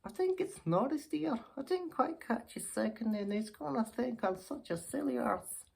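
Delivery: put-on high-pitched, fussing old dear; flustered old lady. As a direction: play a self-conscious old lady up high. Old lady unsure of the name.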